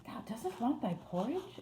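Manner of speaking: high, incredulous Yorkshire question; exclaiming. A narrator's reading. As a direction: exclaim in disbelief as a Yorkshire maid. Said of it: "exclaimed Martha".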